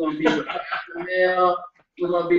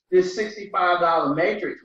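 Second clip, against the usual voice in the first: usual speaking voice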